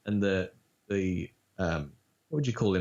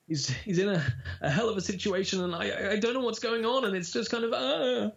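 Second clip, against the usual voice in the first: high, panicked stammer; acted distress, rising to a high wail; pitched up high; 12 semitones higher than usual. Shown as a demonstration. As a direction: own voice pushed right up high. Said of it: "up there".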